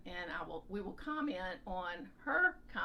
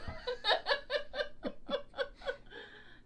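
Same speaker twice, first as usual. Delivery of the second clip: 1 high breathless laugh; 3 dB louder than usual. Real, not acted. Reacting to husband's joke.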